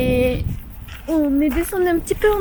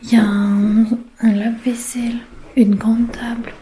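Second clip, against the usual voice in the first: whispering; 4 semitones lower than usual. Real, not acted. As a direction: whisper the tour so nobody wakes. Quiet home tour, whispered.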